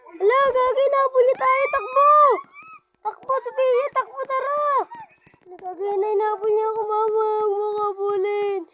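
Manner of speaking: frantic high yells while fleeing; panicked shouting. Real, not acted. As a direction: scream for help, running for your life. Chased, running away.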